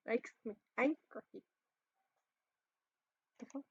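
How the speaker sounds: usual speaking voice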